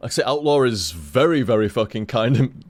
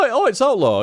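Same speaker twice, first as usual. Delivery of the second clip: light surprise; 8 semitones higher than usual. Shown as a demonstration. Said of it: "it was more like".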